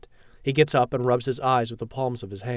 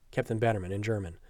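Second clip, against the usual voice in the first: ordinary delivery, nothing marked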